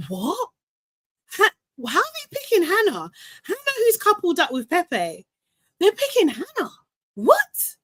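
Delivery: high, incredulous squeals of disbelief; shocked disbelief. Retold or imitated. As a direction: squeal it like you can't believe the vote. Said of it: "which I was just like".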